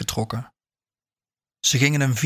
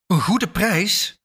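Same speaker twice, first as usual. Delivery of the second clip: slow, higher-pitched disbelieving question; incredulous echo; 7 semitones higher, much slower than usual. A narrator's reading. Buyer echoes the seller's asking terms back at her, incredulous. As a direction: draw out GOEDE in rising disbelief.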